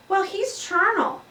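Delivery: put-on high, loud little girl voice; bright know-it-all child; eager child. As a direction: pipe it up like a keen schoolgirl. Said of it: "replied Raise Your Hand Heidi".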